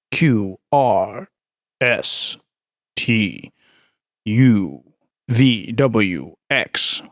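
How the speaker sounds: very low, slow alphabet; James Earl Jones reciting. Shown as a demonstration. Streamer reciting Sesame Street ABCs as James Earl Jones. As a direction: rumble each letter gravely. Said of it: "doing the A B Cs from Sesame Street".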